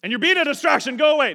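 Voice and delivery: sudden loud, high-pitched outburst; hammed-up bad-father shout; mock angry yell. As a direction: explode at the child as a joke. Joke outburst at daughter, then retracted.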